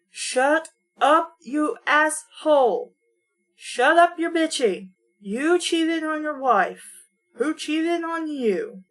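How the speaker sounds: high, loud, drawn-out scolding; indignant yell at a character, words stretched out; exasperated outburst. Real, not acted. Reader yells at the story's character.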